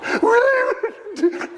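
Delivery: imitating a child's shriek, very high; mock high scream-laugh. Retelling brother's fear, a high shrieking wail. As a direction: squeal like the terrified little brother.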